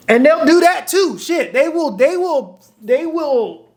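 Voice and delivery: high, sing-out insistence, swooping up and falling on each repeat; animated insisting. Real, not acted. Dating coach swearing to viewers that women will do it, animated insisting. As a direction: insist excitedly, pitched well above normal talk.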